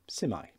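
North Welsh Simai, see-my; Welsh greeting. Shown as a demonstration. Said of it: "North Wales, you might say".

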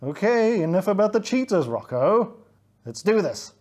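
loud, impatient fox character voice; yelling fox. Acted. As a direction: yell to cut off the chatter. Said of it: "yelled Steve".

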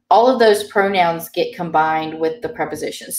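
normal talking voice

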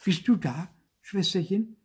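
high, hopeful puppet voice; delighted, startled. A narrator's reading. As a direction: ask in high, startled delight, not really whispered. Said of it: "flüsterte Zäpfel entzückt und erschreckt". (hoch, entzückt und erschreckt)

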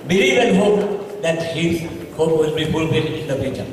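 normal talking voice

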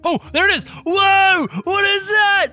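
high, loud screams of alarm; high-pitched game scare yelling; panicked shouting. Real, not acted. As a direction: shout in panic as the monster comes. Flees a monster in game.

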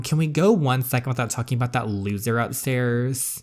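usual speaking voice

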